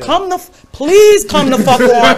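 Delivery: hyped-up taunt, slowed; taunting the Proud Boys. Retold or imitated. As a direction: come on, bring it, teasing. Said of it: "I said".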